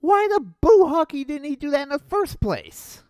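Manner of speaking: normal talking voice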